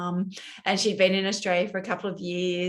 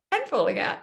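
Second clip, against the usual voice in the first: high laugh into a wry aside; self-mocking laugh about thinning hair; 9 semitones higher, faster than usual. Real, not acted. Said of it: "laughing at own hair".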